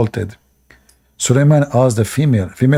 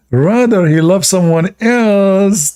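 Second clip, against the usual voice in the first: high bird voice; 7 semitones higher than usual. Acted. Female bird continues her complaint.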